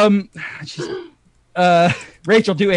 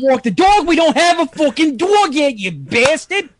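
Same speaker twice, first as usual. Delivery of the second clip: higher, agitated mock New Yorker; fast hammed-up Brooklyn rant; Brooklyn accent; 5 semitones higher than usual. Shown as a demonstration. New Yorker griping at a spouse about the dog, Brooklyn accent. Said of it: "Brooklyn accent".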